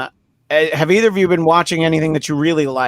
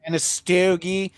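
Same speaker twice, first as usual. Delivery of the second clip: hammed-up Pennsylvania accent, pitched up; 4 semitones higher than usual. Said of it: "I fucking hate Pennsylvania accents".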